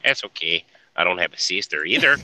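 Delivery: loud cartoon Mexican accent. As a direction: bark it like a cartoon Mexican bandit. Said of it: "a Mexican accent".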